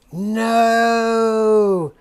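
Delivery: loud, high drawn-out no; a loud, stretched-out no of letdown; long dismayed no. Real, not acted. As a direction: let the no sag in dismay. Loud disappointed exclamation.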